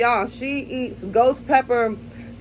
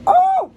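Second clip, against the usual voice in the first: sudden high yelp at the heat; a shocked squeal as the spice hits; real startled yelp; pained yelp; 6 dB louder than usual. Real, not acted. Burning mouth from spicy chip.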